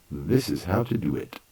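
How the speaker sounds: ordinary talk, nothing marked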